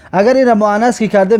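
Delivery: normal talking voice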